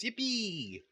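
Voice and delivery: high, gleeful little cheer; excited cheer. Real, not acted. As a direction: squeal it with delight. Cheering over free donuts.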